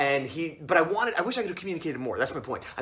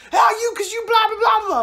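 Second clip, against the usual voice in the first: loud, very high garbled tirade; mock ranting; 8 dB louder than usual. Driver cutting off narrator's excuse, hammed-up raving. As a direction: blurt an exaggerated yell that dissolves into blather. Driver's rant cuts in again.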